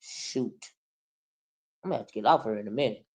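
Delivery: low, fast under-the-breath aside; muttered aside. Real, not acted. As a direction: mutter it quickly to yourself.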